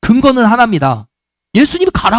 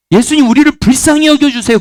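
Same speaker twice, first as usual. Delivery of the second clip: raised pleading cry for mercy; lepers crying out; 6 semitones higher than usual. Retold or imitated. Lepers calling to Jesus, pleading cry for mercy. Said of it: "그렇게 외쳤잖아요".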